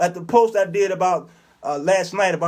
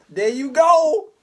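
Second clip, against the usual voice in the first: high mock approval; 8 semitones higher, slower than usual.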